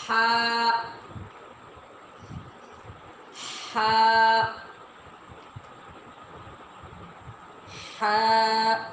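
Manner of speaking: ہا from the throat, like a cold breath out; breathy throat ha. Shown as a demonstration. Teacher models throat ہا for children. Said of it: "اس طرح آپ بولیں گے".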